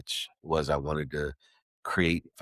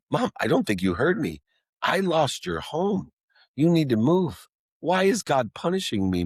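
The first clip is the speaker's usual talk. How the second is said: desperate plea; 10 semitones higher than usual. Retold or imitated. Son insisting to his mother she didn't hear him, higher pleading. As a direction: cry out as if she missed it.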